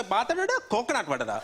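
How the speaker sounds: lightly voiced mum scolding in Sinhala; mum's mock Sinhala gossip; Sri Lankan language. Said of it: "she'll go".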